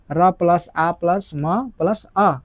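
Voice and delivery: र plus आ plus म plus अ spoken slowly, piece by piece; slow sound blending. Shown as a demonstration. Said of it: "ये पूरा जब जोड़िएगा". (ध्वनियों को धीरे-धीरे जोड़कर बोलना)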